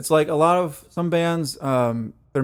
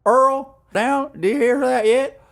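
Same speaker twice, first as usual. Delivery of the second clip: high, louder hammed-up Southern holler; mock Southern accent; 11 semitones higher, 5 dB louder, slower than usual. Cohost joins the Southern joke, hollering in mock accent. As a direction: call out to Earl like a country boy.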